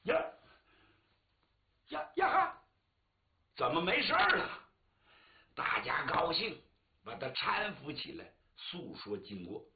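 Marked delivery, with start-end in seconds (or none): 0.0-4.7 high, loud astonished yelps; startled cry. Acted. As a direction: wake up shocked and amazed. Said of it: "周济大叫一声".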